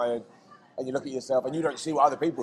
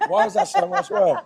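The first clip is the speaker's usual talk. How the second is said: laughing; 6 dB louder than usual. Real, not acted. Chili getting hotter.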